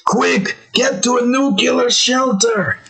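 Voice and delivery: high, urgent Australian character voice; Australian guy. Retold or imitated. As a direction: imitate a frantic cartoon Australian sounding the alarm. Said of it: "he comes up and he says".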